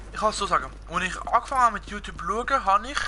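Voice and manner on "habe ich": usual speaking voice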